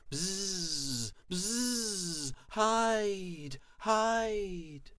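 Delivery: high, drawn-out buzzing insect voice; buzz, then cry it high like a dragonfly; crying dragonflies. A narrator's reading. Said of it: "The dragonflies cried".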